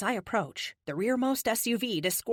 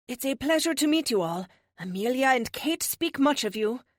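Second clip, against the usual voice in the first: put-on higher-pitched, formal accented greeting; polite foreign visitor; 6 semitones higher, 3 dB louder than usual. Police officer meeting the team, polite accented voice. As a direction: greet them courteously in a raised, accented voice.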